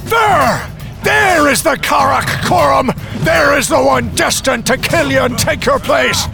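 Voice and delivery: proud wizard shouting; triumphant shout. Acted. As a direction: proclaim it over the battle. Wizard proclaims son in battle.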